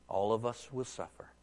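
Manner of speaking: normal talking voice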